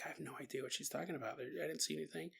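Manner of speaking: very soft, quick aside; hushed, hurried denial; baffled nanny. Acted.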